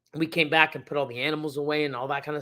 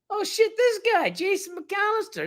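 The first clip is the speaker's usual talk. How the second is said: surprised delight; 14 semitones higher than usual. Real, not acted. Spots old friend in chat.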